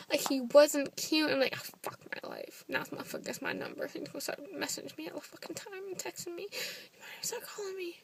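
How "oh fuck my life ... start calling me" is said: hushed, mostly voiceless aside; whispered dread. Retold or imitated. Woman dreading that he has her number, whispered. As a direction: whisper it to yourself in dismay. Muttered dread after his picture.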